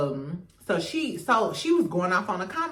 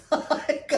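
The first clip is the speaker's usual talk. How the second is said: shocked gasp; 6 semitones higher than usual. Real, not acted. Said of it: "I was appalled".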